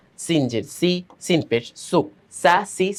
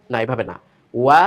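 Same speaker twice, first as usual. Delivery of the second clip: ordinary delivery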